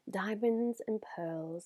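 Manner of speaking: slow breathy whisper of awe. Real, not acted. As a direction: breathe it out in wonder. Admiring palette in whisper.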